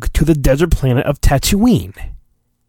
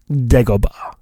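hushed and secretive; whispered; 3 semitones lower than usual. Pretend. Naming the hidden swamp planet in a whisper. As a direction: whisper Dagobah mysteriously.

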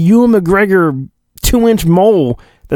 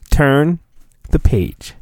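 storybook cue; slower than usual. Pretend. Page-turn cue again.

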